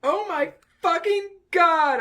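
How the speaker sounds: high, loud, slow hammed-up shriek; mock scream. Reading the fanfic heroine's scream, hammed-up. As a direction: scream it like a fangirl. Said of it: "I screamed".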